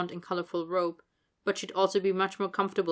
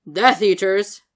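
louder, mocking disbelief; scoffing; 11 dB louder, slower than usual. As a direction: scoff at the silly name. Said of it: "Vernon scoffed".

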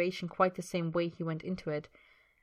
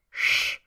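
sharp, loud shush; whispered shush; 6 dB louder than usual. Acted. Man silences his companion while listening at the wall, sharp shush. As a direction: cut them off with a hissed shh. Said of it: "Draco whispers".

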